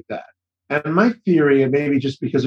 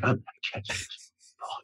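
intense whisper; 3 semitones lower, 14 dB quieter than usual. Retold or imitated. Himself playing catch, determined.

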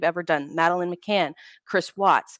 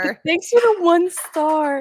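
high, slow sarcastic thanks; 10 semitones higher, 5 dB louder, slower than usual. Acted.

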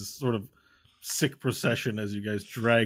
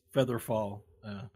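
ordinary talk

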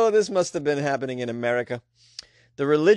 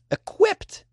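incredulous. Real, not acted. Incredulous echo of the word.

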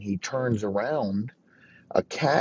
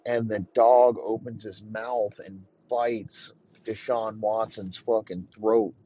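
ordinary talk

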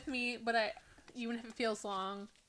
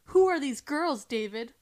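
louder and higher, alarmed; gasped cry; 6 semitones higher, 8 dB louder than usual. A narrator's reading. Bride confronting her groom at the altar, alarmed and loud. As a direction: blurt it out, stunned bride. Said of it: "she gasped".

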